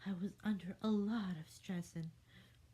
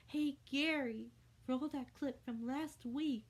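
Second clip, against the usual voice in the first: calling out; 7 semitones higher than usual. A narrator's reading. Host calls to crew.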